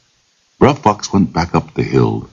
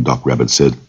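ordinary delivery, nothing marked

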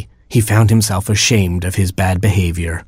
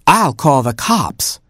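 higher, slow mother hen voice; Mama Hen; 7 semitones higher, slower than usual. A narrator's reading. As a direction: announce it slowly as a fussy hen. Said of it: "said Mama Hen".

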